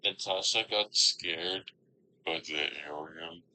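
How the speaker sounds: fading, dying-battery voice filter; low battery voice. Shown as a demonstration. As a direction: let the voice sag like a dying battery. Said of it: "What does low battery sound like?".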